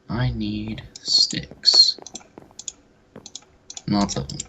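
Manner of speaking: slow whisper to herself. Real, not acted. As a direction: whisper it slowly, thinking. Musing to self while playing.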